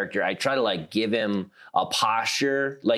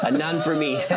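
lightly voiced mom, pitched up, giggling; giggle-softened refusal; 6 semitones higher than usual. Imitating her mom turning something down, high giggly softening. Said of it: "little giggle after she says it".

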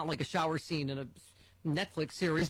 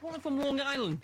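laughing mock Long Island accent, higher; 7 semitones higher than usual. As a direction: lightly mimic a Long Island caller. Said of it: "I just love that in a Long Island accent".

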